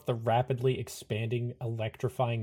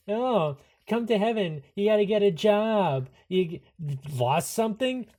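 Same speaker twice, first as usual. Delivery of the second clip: mock sing-song imitation of Heaven's officials, higher; 7 semitones higher, 4 dB louder than usual. Player mocking Heaven's bureaucrats in a higher mock voice. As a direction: sneer it like a snooty clerk. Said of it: "that's just like Heaven. You know".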